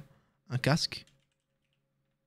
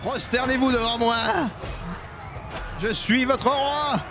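seigneur des ténèbres; 9 semitones higher than usual. Acted. Joue le conquérant qui menace.

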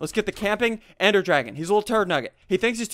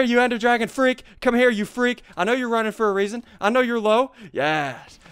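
ordinary talk

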